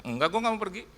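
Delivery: high-pitched retold refusal; insistent protest, acted out; acted arguing. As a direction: refuse as in a heated quarrel, voice jumping up. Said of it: "kita bertengkar setengah jam".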